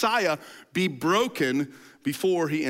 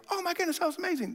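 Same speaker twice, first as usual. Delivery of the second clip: much higher, fast and breathless with glee; giddy; 13 semitones higher, 5 dB quieter, much faster than usual. Acted. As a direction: rattle it off like giddy friends. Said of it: "they're so giddy".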